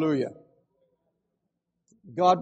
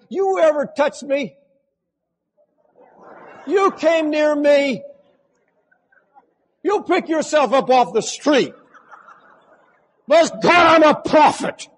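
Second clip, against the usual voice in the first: loud, higher, threatening challenge; mock fighter threat; 8 semitones higher, 7 dB louder than usual. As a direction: throw down a dare like a hothead preacher. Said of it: "I used to be a fighter".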